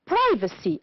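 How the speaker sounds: "Privacy" with PRI stressed, then falling; stress on first syllable. Shown as a demonstration. Teacher asking the class to listen again, first syllable of "Privacy" stressed. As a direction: hit the start of "Privacy". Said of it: "The stressed syllable is in bold type".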